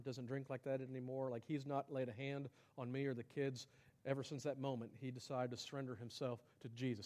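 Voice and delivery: low, soft voice of a confiding wife; subdued testimony. Acted. Wife telling of her husband's changed life, subdued.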